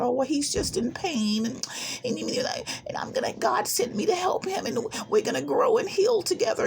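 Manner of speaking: put-on high, airy parody of her hopeful old self; high mocking sing-song; mocking my naive self. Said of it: "I'm not making fun of myself".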